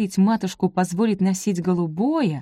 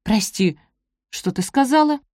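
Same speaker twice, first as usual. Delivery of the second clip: higher, surprised question; 5 semitones higher than usual. A narrator's reading. Гиацинта asks Софи to repeat what she said, surprised. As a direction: ask in mild astonishment, voice lifting. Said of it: "удивлённо взглянула на неё".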